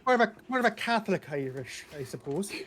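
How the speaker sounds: Irish lilt with a laugh at the end; Irish accent. Pretend. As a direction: toss off a wry Irish aside. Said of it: "hard to improv Irish".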